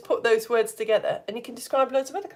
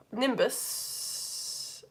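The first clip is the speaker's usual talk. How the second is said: soft nimbus trailing into a long sss; long running-water hiss; 10 dB quieter than usual. Shown as a demonstration. Teacher recalling the rain cloud, long hissed nimbus. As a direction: hiss the last sound on and on. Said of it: "try and make anyone in the room with you need the toilet".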